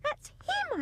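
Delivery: put-on boy proving his point to mother; child crying out. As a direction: blurt it out, vindicated. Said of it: "I cried".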